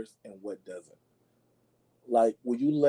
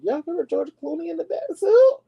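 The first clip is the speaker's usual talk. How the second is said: squeaky put-on high voice, much higher; mock incredulous; 6 dB louder than usual. Vlogger scoffing at Clooney's Batsuit in a squeaky voice.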